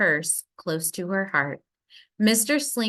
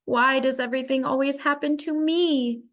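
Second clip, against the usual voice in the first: higher, put-on little girl's whine; sulky child whine; 6 semitones higher than usual. A narrator's reading. Lily sulks alone in her chair, whining.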